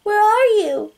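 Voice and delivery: put-on high, calling little girl. A narrator's reading. Girl posing as a lost six-year-old, high little girl voice. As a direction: call out like a lost small child. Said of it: "She puts on her best little girl voice as she calls out".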